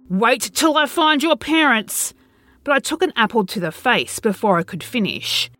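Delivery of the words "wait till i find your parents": higher, yelled threat; yelling threat. Acted. Man yells at kids pelting him with fruit, angry yell. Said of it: "I started to yell".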